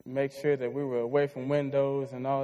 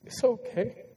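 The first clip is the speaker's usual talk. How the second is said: higher, gentle motherly reassurance; mother's comforting voice; 5 semitones higher than usual. Retold or imitated. Mom calming her crying son during a thunderstorm, tender. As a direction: soothe a frightened child like his mom did. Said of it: "she looked at me and said".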